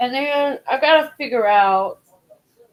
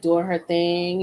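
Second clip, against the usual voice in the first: normal talking voice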